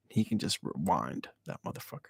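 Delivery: hushed unvoiced whisper. Real, not acted. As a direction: drop to a whisper for the aside. Aside said under breath.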